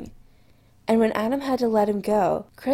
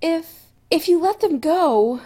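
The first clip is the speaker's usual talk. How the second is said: higher, louder, pleading stammer; desperate; 8 semitones higher, 6 dB louder than usual. A narrator's reading. Chris to Adam, desperate pleading blurt. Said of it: "in a desperate voice".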